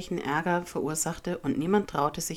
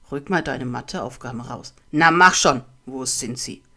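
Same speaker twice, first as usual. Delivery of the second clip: low bully voice bursting into a shouted order; bullying demand; 4 semitones lower, 8 dB louder than usual. A narrator's reading. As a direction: push the victim around, low then suddenly very loud. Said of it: "pöbelte ihn André an". (pöbelnd fordernd, dann laut)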